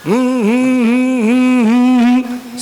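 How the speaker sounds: short, pointed hums as a signal; deliberate hmm-hmm cue for the crowd to clap; humming cue. Shown as a demonstration. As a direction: hum the clapping cue in a slow rhythm. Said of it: "जब मैं ऐसे करूं".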